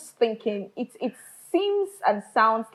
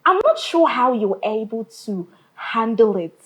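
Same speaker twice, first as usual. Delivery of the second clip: ordinary talk, nothing marked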